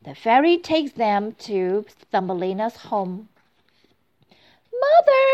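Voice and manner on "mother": high, loud cry of Mother!; joyful shout. A narrator's reading. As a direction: call out to her mother in delight. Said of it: "shouts Thumbelina".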